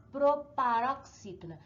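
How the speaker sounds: ordinary talk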